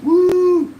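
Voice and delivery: high, loud woo of welcome; greeting a viewer with a loud high whoop; excited cheer. Real, not acted. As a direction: whoop with joy for a friend arriving.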